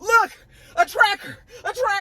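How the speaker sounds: high, loud, sing-song "here it is, look"; mock excited find. [name] showing Vic the tracker, in a hammed-up high excited voice. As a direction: play up the fake discovery like a big reveal.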